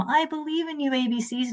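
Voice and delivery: high, soft mock-devotion; mock earnest belief. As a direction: cheer on the alphabet in a small, high, silly voice. Mocking belief in the alphabet.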